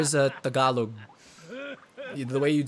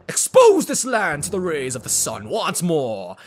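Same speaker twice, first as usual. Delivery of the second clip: loud, raised, drawn-out grand proclamation; booming villain demand; 5 semitones higher, 7 dB louder, slower than usual. Acted. Villain demanding Hyrule from the gods, booming. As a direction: cry out to the heavens with slow, swelling endings.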